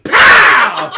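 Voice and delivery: loud, high breathy pow; mock gunshot. Acting out blasting the enemies, loud pow. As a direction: spit out the gun blast. Gunshot sound in game story.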